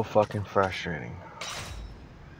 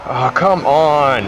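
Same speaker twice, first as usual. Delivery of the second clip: exasperated groan; 6 semitones higher, 10 dB louder, much slower than usual. Real, not acted. Failing the race yet again.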